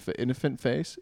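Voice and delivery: ordinary talk, nothing marked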